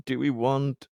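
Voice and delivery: normal talking voice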